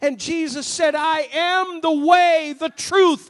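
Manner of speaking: loud, climbing proclamation; rising preaching. Acted. Preacher proclaiming Jesus's words to the congregation, loud. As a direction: build it up loud. Climax of sermon.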